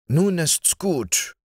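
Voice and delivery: slow, high gentle warning; a slow inner voice saying enough; voice of conscience. A narrator's reading. Conscience warns Georg to stop, slow and high. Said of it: "Sein Gewissen sprach".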